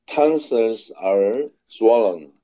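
ordinary delivery

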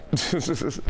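short high-pitched chuckle; amused chuckle. Real, not acted. Rapper joking to interviewer about royalties, amused chuckle. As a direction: chuckle at your own remark. Laughs at his own bills remark.

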